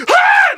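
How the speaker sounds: very high, loud shriek; mock crowd scream. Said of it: "everyone's just like".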